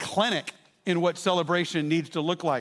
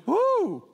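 high mock "woo" from a crowd; high-pitched imitation whoop; imitating crowd cheer; 11 semitones higher, 3 dB louder than usual. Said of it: "the crowd responded with".

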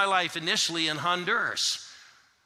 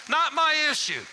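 much higher, louder call; shouted; 10 semitones higher, 5 dB louder than usual. Shown as a demonstration. Preacher leading the crowd in a shouted catchphrase. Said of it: "shout it with me".

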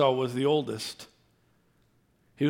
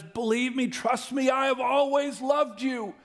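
higher, earnest plea as God; God pleading; 9 semitones higher than usual. Acted. Preacher voicing God pleading with his people. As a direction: beg them to believe. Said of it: "God says".

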